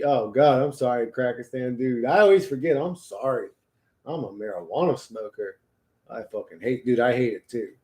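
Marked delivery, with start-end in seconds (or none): none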